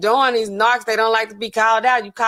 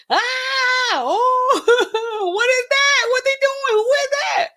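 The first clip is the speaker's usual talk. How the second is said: high-pitched mock wail of shock; acted first reaction; 14 semitones higher, slower than usual. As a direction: react with exaggerated, squealing disbelief. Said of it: "have a first reaction".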